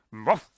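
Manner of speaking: short mock dog bark, ruff; dog bark impression. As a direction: bark it like a dog, just once. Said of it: "That's my dog impression".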